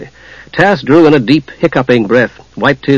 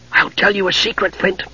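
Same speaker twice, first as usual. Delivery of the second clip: hushed, higher stage whisper; loud whisper; 6 semitones higher, 7 dB quieter than usual. A narrator's reading. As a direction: confide it softly but audibly. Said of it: "said in a loud whisper".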